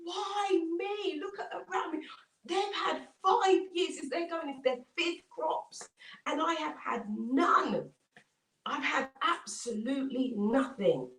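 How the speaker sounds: high, wailing mock-tearful plea; hammed-up anguished cry. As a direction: wail in acted despair, easing down toward the end. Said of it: "And he's crying out".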